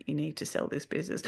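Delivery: soft, hushed, like a thought heard in her head; quiet inner voice. Retold or imitated. Sensing her husband silently thinking, hushed. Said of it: "feel him thinking".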